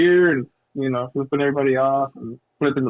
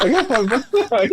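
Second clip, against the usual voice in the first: higher, laughing through the line; laughing impression; 7 semitones higher than usual. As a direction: giggle the movie quote. Repeating the quoted line.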